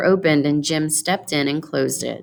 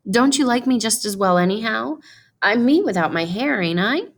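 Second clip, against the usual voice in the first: put-on higher, eager pleading young wife; Della, pleading; 7 semitones higher than usual. Della pleads with her husband over her cut hair, higher and eager. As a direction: rush to win him over, anxious and hopeful.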